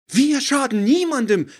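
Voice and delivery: high, loud, outraged protest; indignant outcry. Acted. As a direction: fire back in indignation. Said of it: "rief von Gerteljung aufgebracht".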